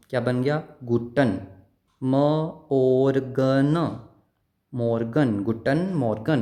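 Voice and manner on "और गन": drawn-out syllable गन for learners; syllable demo. Shown as a demonstration. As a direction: stretch out गन very slowly and louder. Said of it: "मैंने क्या sound बताई थी".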